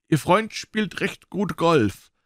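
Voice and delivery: doctor. A narrator's reading. Doctor speaks at dinner.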